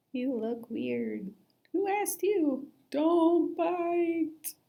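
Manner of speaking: high, odd fish voices; little fish voices. A narrator's reading. Fish taunting and warning each other, high and squeaky.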